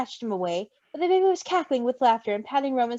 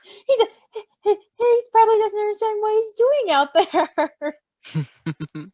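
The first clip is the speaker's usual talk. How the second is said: slow, giggling and breaking up; laughing through words; 9 semitones higher, 4 dB louder, slower than usual. Real, not acted. Reader laughs at poor Roman, giggling through words. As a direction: try to read while cracking up.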